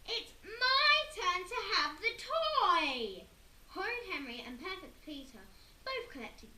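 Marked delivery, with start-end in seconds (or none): 0.1-3.3 high, loud, slow shout; loud whine. Acted. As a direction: yell your claim like a brat. Boys fighting over toy.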